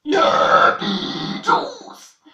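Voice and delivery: "Yapi juice" belched out very slowly; very slow burped phrase. Shown as a demonstration. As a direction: draw "Yapi juice" out on a long burp. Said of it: "en rotant".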